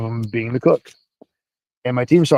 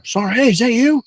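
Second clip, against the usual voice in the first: high-pitched, sing-out hail of recognition; imitated calling out; 11 semitones higher than usual. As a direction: call out high, imitating someone who spots an old acquaintance. Retold voice calling out from behind.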